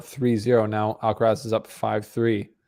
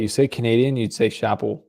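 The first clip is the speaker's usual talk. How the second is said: ordinary talk, nothing marked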